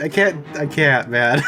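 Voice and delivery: ordinary talk, nothing marked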